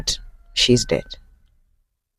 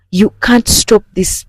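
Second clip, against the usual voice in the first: exasperated scolding; 7 semitones higher than usual. Retold or imitated. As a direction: tell him off, getting hotter. Wife berates cheating husband.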